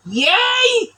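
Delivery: excited cheer. Real, not acted. Cheering after finishing the neck.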